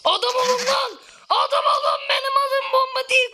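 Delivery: furious gamer shout; angry yell. Real, not acted. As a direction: shout at the teammates. Yelling at teammates in game.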